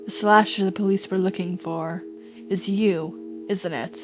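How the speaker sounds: soft, low, level accusation; calm accusation. Acted. Girl naming the writer as slasher, calm soft accusation. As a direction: press the charge very quietly. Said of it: "completely different from how calm you were".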